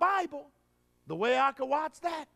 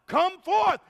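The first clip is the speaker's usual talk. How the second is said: higher, loud, ringing "Come forth"; loud command; 6 semitones higher, 6 dB louder than usual. Acted. Jesus commanding Lazarus to rise, loud high cry. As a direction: call the dead man out of the grave. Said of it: "he cried with a loud voice".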